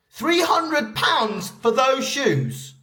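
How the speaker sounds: high-pitched acted disbelief, rising further at the end; mock incredulous. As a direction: act appalled at the price, voice climbing. Acted example of outrage at price.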